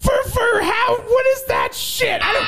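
high, slow outraged yell; outraged shout. Acted. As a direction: shriek it in mock outrage. Mock outrage at girlfriend.

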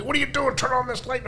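high, mock yelling at the guest; shrill-pitched scold over a light switch; retold angry yell. Studio guy yelling at the broadcaster for the light, mock angry yell. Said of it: "yelling and screaming at me".

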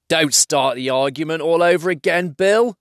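quarrelling troll. Acted. Trolls bicker in the story.

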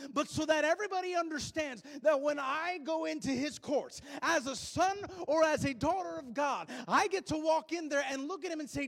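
loud, impassioned preaching to the congregation; full-force preaching. Acted.

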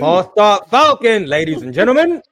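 ring-announcer bit; announcer call. Acted. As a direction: present him like a ring announcer. Announcing a nickname.

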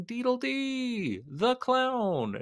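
high, slow, drawn-out clown name. Shown as a demonstration. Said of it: "My name is Deedle D the Clown".

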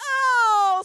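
very high, loud groan of oh; the whole class recoils; chorus of disgust. A narrator's reading. Classroom reacts to the poop, high and loud. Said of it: "said everyone in the classroom".

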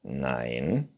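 low, drawn-out refusal; Neinhorn. A narrator's reading. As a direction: refuse slowly as the unicorn. Unicorn figure refusing. (tiefes, gedehntes Nein)

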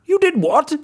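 high, loud outraged cry; wolf outraged at the pig, loud; wolf shouting in disbelief. Acted. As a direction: shout in shock. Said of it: "shouted the wolf".

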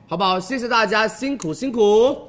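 rally the buyers, stretch the ending; hard sell ad. Acted. Host hypes link going live.

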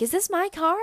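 high, loud, mock-thrilled girl; imitated excitement. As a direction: mimic a girl thrilled by her new car. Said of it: "Like, she's excited".

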